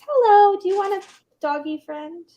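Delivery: high, loud sing-song greeting; pet talk. Real, not acted. Owner greeting her pet rabbit, high doting pet voice.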